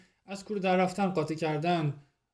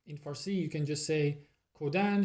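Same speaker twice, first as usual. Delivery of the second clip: ordinary talk, nothing marked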